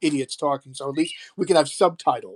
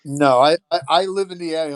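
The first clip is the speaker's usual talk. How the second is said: usual speaking voice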